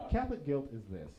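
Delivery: ordinary talk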